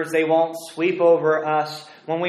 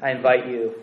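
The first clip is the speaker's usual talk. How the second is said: normal talking voice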